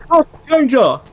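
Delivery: high, slow, drawn-out transformation cry; henshin shout. Pretend. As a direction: shriek it like a hero transforming. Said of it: "shout out or a roll call".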